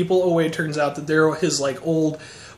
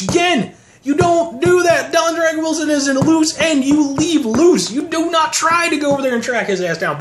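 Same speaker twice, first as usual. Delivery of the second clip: high shouted rant; 10 semitones higher than usual. Real, not acted. Outraged fan shouting at villains who hunt Don Dragon Wilson. Ranting that nobody hunts Don.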